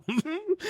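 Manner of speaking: real high breathy laugh; squeaky laugh. Real, not acted.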